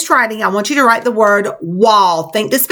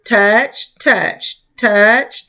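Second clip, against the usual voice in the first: "touch" repeated in a steady beat, rise and fall; teacher pacing a careful stroke; careful tapping rhythm; much slower than usual. Shown as a demonstration. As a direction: count out each touch deliberately. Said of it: "Make sure that you're taking your time".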